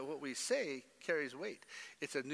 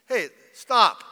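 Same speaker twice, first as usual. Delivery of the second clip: much louder sharp stop; loud command; 6 semitones higher, 17 dB louder than usual. Real, not acted. Pastor ordering the congregation to drop offenses, loud. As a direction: halt them with a shout.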